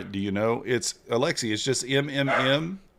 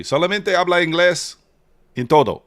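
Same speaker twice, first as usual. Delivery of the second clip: loud, higher-pitched Spanglish; terrible Spanglish; 6 semitones higher, 5 dB louder than usual. Real, not acted. Streamer answering his chat in Spanish, terrible Spanglish. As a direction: push out clumsy Spanglish, raised voice. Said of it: "That's terrible Spanglish?".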